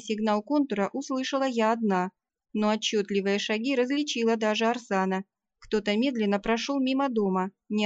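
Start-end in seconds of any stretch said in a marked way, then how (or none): none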